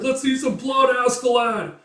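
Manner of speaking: high, loud sustained yell; rowdy heckling. Acted. Spectator to the chief in a duel, rowdy yell. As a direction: egg the fighter on from the crowd. Crowd egging on a fighter.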